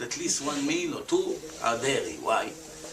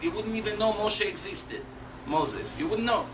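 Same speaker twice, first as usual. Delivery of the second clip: ordinary talk, nothing marked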